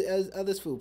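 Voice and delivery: ordinary delivery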